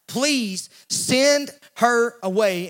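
slow, stretched plea to send her away; drawn-out plea. Retold or imitated. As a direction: drag out the disciples' begging. Disciples voiced begging.

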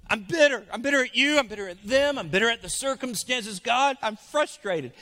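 exasperated, loud grievance; frustrated prayer. Acted. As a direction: vent bitterly at God. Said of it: "We say".

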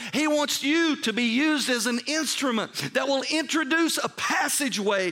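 high, driving preacher voice; impassioned preaching. Real, not acted. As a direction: preach it hard, stretching out the key words. Preaches at full force.

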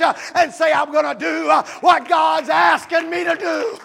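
loud, high pleading shout; preaching at full force. Acted. Pastor begging the hearers to grow up, shouting.

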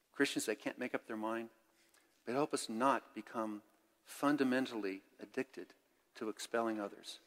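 earnest spoken prayer to God; pastor's benediction prayer; closing prayer. Acted. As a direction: pray for the church with calm sincerity.

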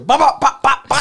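loud, high popping gunfire noises; punchy rapid bops; imitated gunshots. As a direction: fire off mouth-made gunshots. Imitates gunshots heard round corner.

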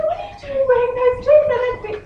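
high, loud, fast scolding; hammed-up squeal of exasperation; mock disbelief. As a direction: imitate an incredulous mum challenging her daughter. Mum challenges daughter wearing jeans.

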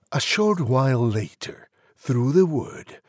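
ordinary delivery

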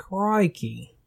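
high light Aussie crikey; mock Aussie. Friend jokes about Australians with a light mock Aussie crikey. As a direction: toss out a playful Aussie crikey. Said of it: "Australian exclamation".

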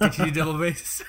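asking through laughter; laughing. Real, not acted. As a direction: laugh while you ask. Laughing repeat.

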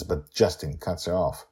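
plain reading voice

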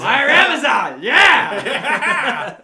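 over-the-top roaring outburst; wild shout. Acted. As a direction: yell it huge and drawn-out. Mock outburst while riffing on Gary Busey.

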